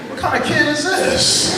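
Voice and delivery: high, loud worried thought; mock alarm. Speaker relives his nervous thought as the kid approaches, high and loud. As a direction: panic inside at the approaching kid. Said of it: "I'm thinking".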